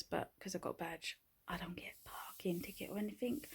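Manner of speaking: whispered vlog update; whispering. Real, not acted. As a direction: whisper so no one wakes.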